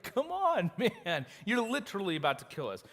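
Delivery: high, loud come on, man; exasperated plea. Retold or imitated. Men of Judah begging Samson to stop, loud and high. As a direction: throw up your hands at a reckless friend. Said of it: "you can just hear it".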